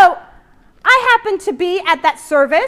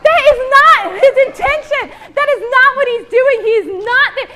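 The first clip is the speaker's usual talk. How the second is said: louder and higher, hammering each not; forceful denial; 7 semitones higher, 7 dB louder than usual. Real, not acted. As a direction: preach it hard, insist. Preacher rebuts the blowtorch image.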